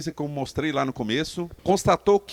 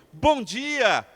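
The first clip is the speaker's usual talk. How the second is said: warm greeting; 10 semitones higher, 4 dB louder than usual. Shown as a demonstration. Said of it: "Eu quero que vocês digam".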